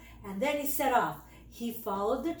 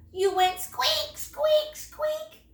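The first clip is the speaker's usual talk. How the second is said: put-on squeaky baby noises; 14 semitones higher, 4 dB louder, slower than usual. A narrator's reading. Brother mimicking baby Squeak's squeaks, rising high. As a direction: squeak climbing much higher.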